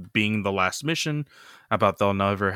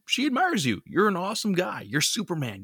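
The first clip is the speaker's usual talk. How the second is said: gushing fan; 9 semitones higher than usual. Retold or imitated. Floyd gushing to Superman.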